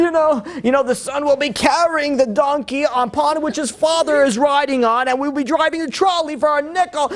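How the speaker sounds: hammed-up indignant passerby, stretching the words; ranting old-timer. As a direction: rant about kids these days. Ranting Brooklyn passerby acted.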